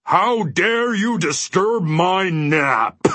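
high, slow, outraged giant bellow; furious bellow. A narrator's reading. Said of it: "he bellowed furiously".

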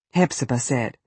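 usual speaking voice

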